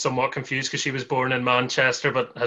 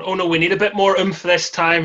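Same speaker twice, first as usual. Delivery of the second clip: demanding director; 5 semitones higher, 5 dB louder than usual. Retold or imitated. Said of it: "they're going".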